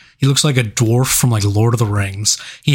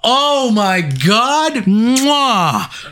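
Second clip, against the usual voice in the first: gushing; 9 semitones higher, much slower than usual. Real, not acted. Reacting to the scene.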